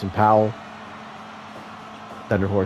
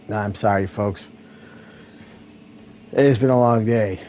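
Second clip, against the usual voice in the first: normal talking voice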